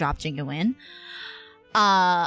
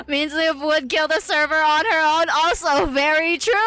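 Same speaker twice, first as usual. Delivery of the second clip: higher, louder, on the edge of laughter; amused exclamation; 8 semitones higher, 4 dB louder than usual. Real, not acted. Streamer agreeing with chat about crashed servers, amused exclamation.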